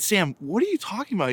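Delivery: high-pitched puzzled question; baffled father. Retold or imitated. Father questions his son's odd complaint, mock bafflement. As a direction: imitate a parent asking in disbelief.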